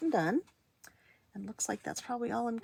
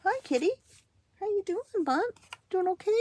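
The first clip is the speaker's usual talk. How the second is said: pet talk; 11 semitones higher, 8 dB louder than usual. Real, not acted. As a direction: coo at the kitty, much higher and louder. Greeting the cat.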